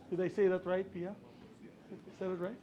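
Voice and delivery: quiet aside. Real, not acted. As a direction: drop your voice to check with a colleague. Quick aside to check a name.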